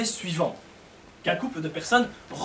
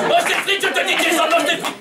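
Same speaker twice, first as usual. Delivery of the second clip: exasperated parent; 8 semitones higher than usual. Acted. Mother on the train yelling at Jason to eat his fries. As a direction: shriek the order at the kid. Parent scolding child, heavier accent.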